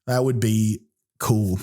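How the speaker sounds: normal talking voice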